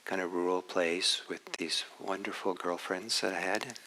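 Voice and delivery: ordinary talk, nothing marked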